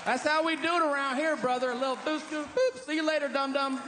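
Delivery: high-pitched hammed-up good old boy; mock Texan bro. Comic still voicing the Texan, high and cocky. Texan character keeps going.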